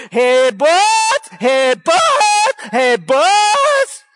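loud, high herder's holler; shepherd's call. Retold or imitated. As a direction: sing it out to the sheep across the pen. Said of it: "The shepherd goes out and he calls".

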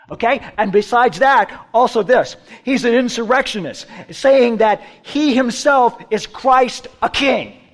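loud, high, piled-on accusations; insistent accusing. Retold or imitated. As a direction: press the charge like an eager accuser. Voices accusers piling on charges.

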